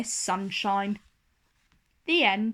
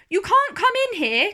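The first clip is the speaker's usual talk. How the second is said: high, louder, fast cry of protest; 9 semitones higher, 6 dB louder, faster than usual. A narrator's reading. Little Miss Sunshine tells Mister Clumsy he can't come in, a high quick cry. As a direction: call out to stop him, quick. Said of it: "cried Little Miss Sunshine".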